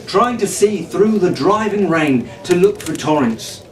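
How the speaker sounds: ordinary delivery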